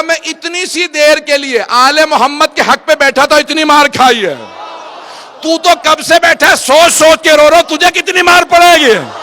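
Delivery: building to a very loud, high, slow wail; impassioned wailing shout. Acted. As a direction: preach the warning at full force, near sobbing. Bahlul's warning, sermon peak.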